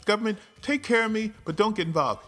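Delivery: high, fast mocking whine; mocking voters. Mocking voters who want government care, high fast whine. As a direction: parody their contradictory demand. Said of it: "but you want the government".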